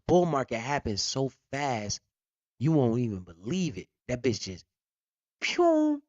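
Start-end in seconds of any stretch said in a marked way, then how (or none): 5.4-6.0 high pew like a rocket taking off; quick high rocket noise; rocket sound. Retold or imitated. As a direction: zip it skyward. Price shoots up like rocket.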